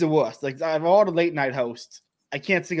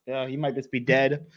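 usual speaking voice

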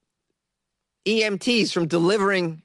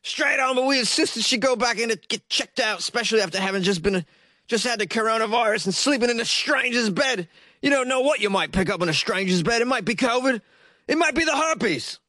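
higher-pitched put-on British accent, quoting the son; 5 semitones higher than usual. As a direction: read the son's quote in a mock British accent. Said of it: "sore throat in certain sections of England".

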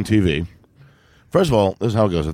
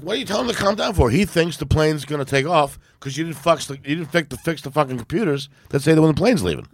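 high-pitched, exasperated challenge; retold indignant protest; 6 semitones higher than usual. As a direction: re-enact arguing with staff in rising exasperation. Retells his protest to airline staff.

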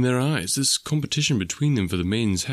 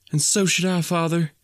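put-on much higher and louder, a spirited girl's voice; 9 semitones higher, 3 dB louder than usual. A narrator's reading. Daughter answering her father with spirit, much higher and louder. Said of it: "she answered with spirit".

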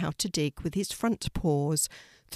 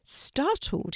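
plain reading voice

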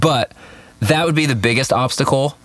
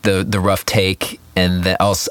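ordinary talk

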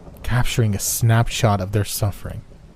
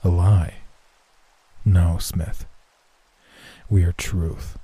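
low, even inhuman tree voice; deep, measured, emotionless entity; deep monotone; 3 semitones lower, slower than usual. A narrator's reading. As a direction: speak as one ancient collective, without inflection. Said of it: "This voice was deep and monotone".

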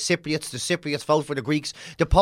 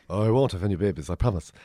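much lower, softer sly promise; mock innocent promise; 10 semitones lower, 4 dB quieter than usual. To the British, a low sly mock promise.

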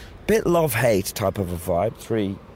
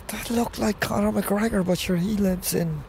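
amazed inner thought; 9 semitones higher than usual. Retold or imitated. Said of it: "I go".

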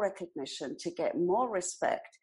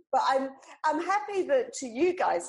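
ordinary talk

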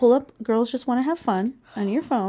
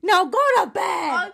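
loud; 8 semitones higher, 5 dB louder than usual. Real, not acted. Telling the kid loudly.

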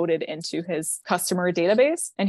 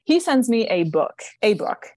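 louder, slower, leaning on a book; exasperated emphasis on a book; indignant emphasis; 4 semitones higher, 5 dB louder, slower than usual. Real, not acted. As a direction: stress the outrage at the length. Outraged at his long text.

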